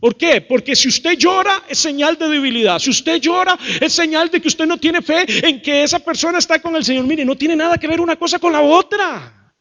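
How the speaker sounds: high, forceful, driving rebuke; indignant preaching. Real, not acted. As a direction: argue it hard from the pulpit, pitch up. Preacher indignant at false teaching.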